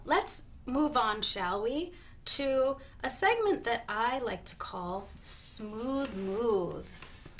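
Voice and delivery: ordinary talk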